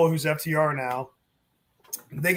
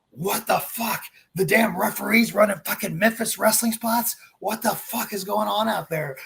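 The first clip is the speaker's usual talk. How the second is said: mock angry boss; 4 semitones higher than usual. Vince yelling about referee mistake.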